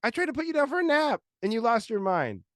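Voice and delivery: high-pitched, loud exasperated retort; mock lecture to his toddler, pitched high; exasperated dad. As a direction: pitch up, calling the kid out.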